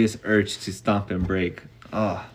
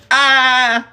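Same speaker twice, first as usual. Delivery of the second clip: high, loud crowd's Ah; crowd gasp; 14 semitones higher, 7 dB louder than usual. A narrator's reading. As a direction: gasp it like a crowd of kids watching a fall. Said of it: "The crowd went".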